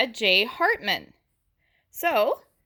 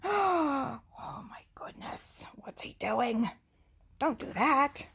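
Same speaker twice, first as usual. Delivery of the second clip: startled whisper; 6 semitones higher, 6 dB quieter than usual. Real, not acted. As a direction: gasp then hushed unvoiced alarm. Teacher reacts aside to child.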